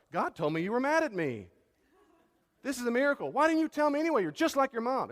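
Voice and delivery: loud, high mock of an upset spouse.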